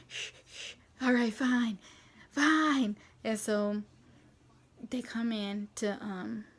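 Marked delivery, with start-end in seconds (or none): 0.1-0.7 soft voiceless puffs; imitated hyperventilating. Husband finally giving in to the mom, imitated panicky breaths. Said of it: "like, hyperventilating or something". 1.0-3.0 exasperated giving in. Retold or imitated. Husband finally giving in on the epidural, exasperated.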